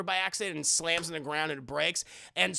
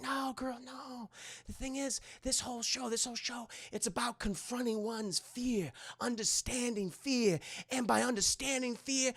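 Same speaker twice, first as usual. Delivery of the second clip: soft mock pep talk; 5 semitones higher, 6 dB quieter than usual. [name] gives pep talk softly.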